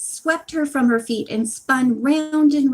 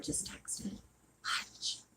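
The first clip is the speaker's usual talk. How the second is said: whisper; 19 dB quieter, much slower than usual. Real, not acted. Aside about landlord text.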